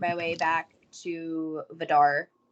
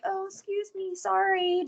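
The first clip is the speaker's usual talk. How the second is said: polite sneaking; 10 semitones higher than usual. Retold or imitated. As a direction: squeeze past apologising politely. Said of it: "so I'm just kind of like".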